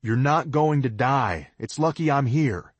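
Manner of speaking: calling down. A narrator's reading. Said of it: "I call down".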